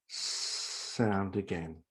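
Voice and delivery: soft sustained hiss on "s-" leading into "sound"; drawn-out S. Shown as a demonstration. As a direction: hold the "s-" quietly before completing "sound". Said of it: "make a soft, gentle s-, sound".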